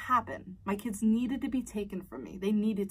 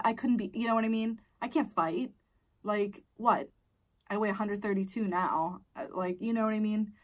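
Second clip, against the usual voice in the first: ordinary talk, nothing marked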